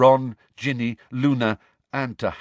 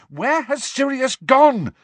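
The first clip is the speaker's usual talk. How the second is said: high, slow, loud yell; drawn-out shouted demand; 6 dB louder, slower than usual. A narrator's reading. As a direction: yell it after someone walking off. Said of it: "Harry yelled after the elf".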